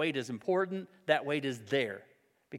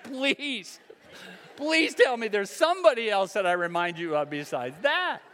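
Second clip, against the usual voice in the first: laughing plea; 3 semitones higher, 4 dB louder than usual. Real, not acted.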